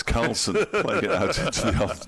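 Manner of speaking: can't keep a straight face; laughing. Real, not acted. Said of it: "I nearly said it with a straight face".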